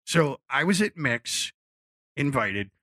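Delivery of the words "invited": ordinary delivery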